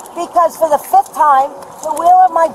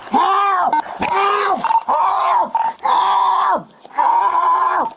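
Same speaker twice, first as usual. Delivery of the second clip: high desperate shouts for help; screaming for help; 6 semitones higher, much slower than usual. Real, not acted. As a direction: yell for help at full voice. Woman screaming during arrest.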